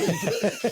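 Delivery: a sudden high, loud laugh; high burst of laughter. Real, not acted. Guest laughing at his own fear of tornadoes. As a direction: break into a laugh at yourself.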